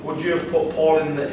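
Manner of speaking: ordinary talk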